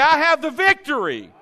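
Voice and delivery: declare it loudly. Shown as a demonstration. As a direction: proclaim it with conviction. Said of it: "say it like you mean it".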